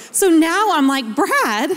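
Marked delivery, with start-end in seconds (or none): 1.2-1.7 high, comic lament to a husband; playfully dramatic, raised-pitch despair; mock dismayed complaint. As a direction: wail it half-jokingly, pitched up. Retold wail to husband.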